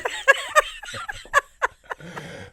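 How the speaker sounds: big burst of laughter; loud cackling laugh; loud high laugh. Real, not acted. Laughs at own story.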